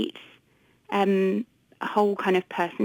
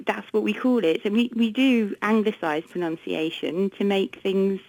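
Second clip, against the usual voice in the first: ordinary talk, nothing marked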